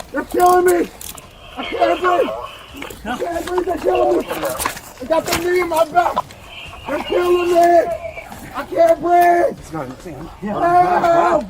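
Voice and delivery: high, strained pleas of distress; desperate pleading. Real, not acted. Man under an officer's knee pleading for air, desperate high cries. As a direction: plead as if being crushed.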